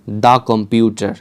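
the computer, very slow, "the" said "daa". Shown as a demonstration. Teacher modelling the article before a consonant, "daa computer". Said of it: "তাহলে কি হবে?".

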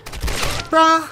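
loud, high drawn-out bruh; mocking taunt. Real, not acted. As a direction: taunt the last enemy. Mocking surviving enemy.

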